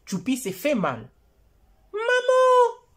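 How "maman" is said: put-on high, loud child's cry for mum; cri d'enfant. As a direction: call out for mum after falling. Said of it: "S'écrie Choupi". (appel aigu et fort d'un enfant)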